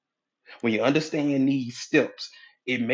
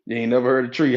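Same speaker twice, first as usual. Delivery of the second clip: ordinary delivery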